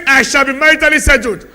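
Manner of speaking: confident declaration. Shown as a demonstration. Pastor leads a believing confession, loud and sure. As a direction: proclaim it as if you believe it.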